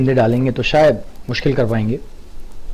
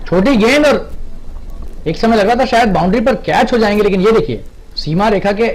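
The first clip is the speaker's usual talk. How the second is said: excited commentary; 6 semitones higher than usual. Real, not acted. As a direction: marvel at the big hit. Six hit, commentator thrilled. (रोमांचित commentary)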